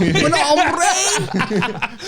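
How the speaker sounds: put-on Scouse accent, laughing; mock Scouse Spanish. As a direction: tease him in Scouse-tinged Spanish through a laugh. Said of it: "The Scouse in you coming out".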